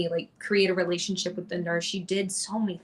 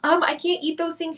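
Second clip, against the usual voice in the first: tearful reply; 10 semitones higher, 5 dB louder than usual. Retold or imitated. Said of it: "I kind of am still crying".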